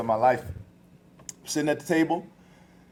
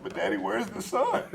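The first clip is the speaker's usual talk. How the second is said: ready to cry; 7 semitones higher, 4 dB quieter than usual. Retold or imitated. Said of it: "she was ready to cry".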